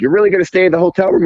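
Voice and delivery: incredulous friend. Retold or imitated. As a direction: rattle it off in mock disbelief. Friend incredulous about staying in.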